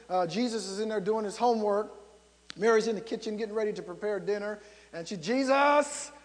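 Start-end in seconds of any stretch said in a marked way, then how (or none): 5.2-6.1 raised, drawn-out mother's call. Acted. Mother in the kitchen calling her son, calling out. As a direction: call a child from another room.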